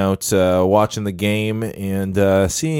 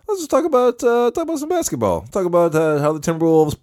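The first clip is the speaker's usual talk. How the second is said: squeaky high voice; 8 semitones higher than usual. Acted. Sudden very high voice.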